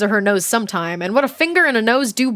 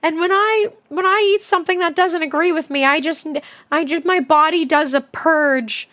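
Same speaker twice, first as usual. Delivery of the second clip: put-on high, wobbly old grandma voice; imitating grandma; 6 semitones higher than usual. Grandma on the phone explaining her stomach, high granny voice. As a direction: put on a much higher sweet granny voice. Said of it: "and she was like".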